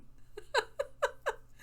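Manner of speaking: squeaky airy giggle; a high, voiceless laugh fit; high breathless laugh. Real, not acted. Laughing at bad accent.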